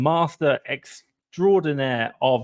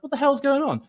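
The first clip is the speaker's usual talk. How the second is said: pitched way up in disbelief; baffled outburst; 14 semitones higher than usual. Retold or imitated. Venting to viewers about Amazon, baffled and pitched high. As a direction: throw it up high like you can't believe it. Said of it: "And I was like".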